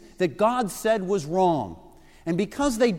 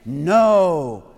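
holy no; 8 dB louder than usual. Shown as a demonstration. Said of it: "a kind of holy no".

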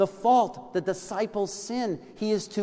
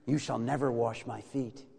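low, soft, firm quote; hushed refusal; 7 semitones lower, 7 dB quieter than usual. Acted. Peter refusing to have his feet washed, quiet and low.

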